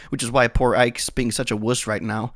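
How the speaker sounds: usual speaking voice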